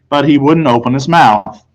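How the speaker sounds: usual speaking voice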